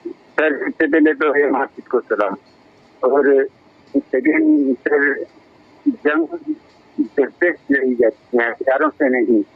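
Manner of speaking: bad phone line cutting out; choppy call audio dropping words; breaking up space radio. Real, not acted. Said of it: "signal का शायद problem है, आवाज़ cut रही है आपकी।".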